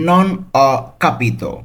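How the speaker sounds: slow, careful Italian phrase; slow model reading. Shown as a demonstration. Teacher models the whole phrase slowly for students. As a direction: say it slowly and clearly for learners. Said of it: "এর Italian কী হবে?".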